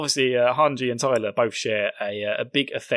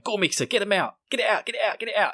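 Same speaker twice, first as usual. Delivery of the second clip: high, urgent repeated demand; Eren impression; 7 semitones higher, faster than usual. Imitating Eren urging Mikasa, high and insistent. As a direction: imitate someone frantically egging a friend on. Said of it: "And then Eren starts going".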